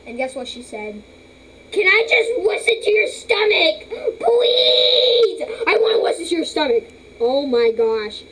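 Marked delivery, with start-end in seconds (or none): 1.7-6.8 begging pet voice. Pretend.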